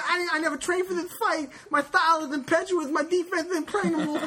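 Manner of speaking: high-pitched, lisping boxer rant; Mike Tyson. Retold or imitated. Retelling a post-fight interview speech to a co-host, imitated high boxer voice. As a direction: rattle off boasts in a high lisp. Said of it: "Mike Tyson, that's the greatest speech".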